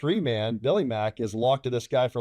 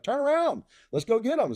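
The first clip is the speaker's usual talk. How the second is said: much higher, pumped-up call to attack; eager order; 12 semitones higher than usual. Retold or imitated. Lead orders his wingman south, eager high call. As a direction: bark it out keen to fight. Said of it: "I tell my two, I'm like".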